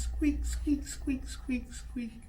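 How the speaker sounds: tiny high squeaking mouse noises, fading away; mouse squeaks. Pretend. Parent making little mouse squeaks for the child, high and fading.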